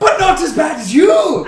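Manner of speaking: put-on slow, high, very loud Midwest accent; hammed-up Midwest shout. Host finishes voicemail insulting podcasters, shouted Midwest accent. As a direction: belt the jab in mock Midwestern. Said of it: "your Midwest accent was fucking insulting".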